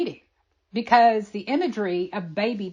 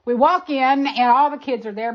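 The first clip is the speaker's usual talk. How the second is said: usual speaking voice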